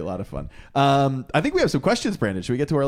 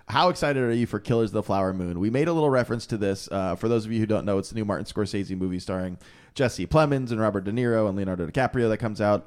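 ordinary delivery

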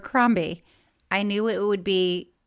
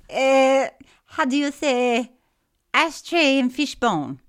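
put-on higher, halting French accent; 4 semitones higher, 5 dB louder than usual. Swiss friend asking for a store, French accent. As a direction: play a French visitor groping for the English words. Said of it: "use French accent for this".

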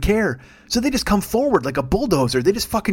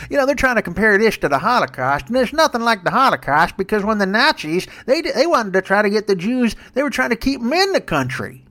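high, slurred Southern politician voice; Jeff Sessions; 4 semitones higher than usual. Acted. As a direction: do a caricature Southern senator. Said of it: "Jeff Sessions ... and he's like".

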